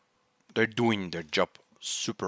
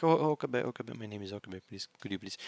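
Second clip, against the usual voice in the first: softer, pleading request; pleading softly; 8 dB quieter, much faster than usual. Retold or imitated. Ferris's father asking Okabe, softer and pleading. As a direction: beg him politely, dropping almost to a murmur. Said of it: "he's like".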